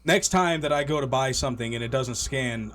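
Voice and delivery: ordinary talk, nothing marked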